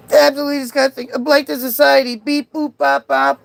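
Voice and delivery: high-pitched mock bot voice; bot falling apart. Streamer parodies heckler's insults, bot falling apart. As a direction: rattle off insults and beeps like a broken bot. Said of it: "Look at the bot falling apart".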